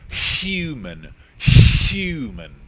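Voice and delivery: HUMAN with a strong, drawn-out breathy H; pronounced H. Shown as a demonstration. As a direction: overdo the H at the start of Human. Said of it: "I pronounce my H's".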